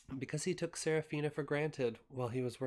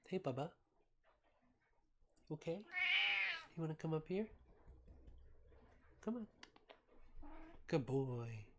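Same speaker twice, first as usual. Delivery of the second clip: soft, higher coaxing voice; pet talk; 6 semitones higher, 5 dB quieter than usual. Real, not acted. Owner softly coaxing her cat onto her lap, higher and softer. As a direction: coax the cat up gently.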